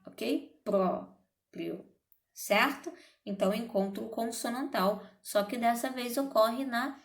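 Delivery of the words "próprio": Próprio said carefully, sounding the P and the R clearly; careful demo. Shown as a demonstration. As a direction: spell out Próprio by its sounds for the class. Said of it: "eu falo primeiro o som de P".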